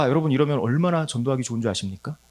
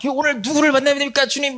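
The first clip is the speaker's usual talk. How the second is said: crying out; 10 semitones higher than usual. Retold or imitated. Crying out to God in prayer.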